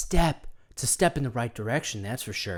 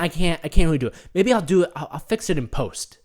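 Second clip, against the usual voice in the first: usual speaking voice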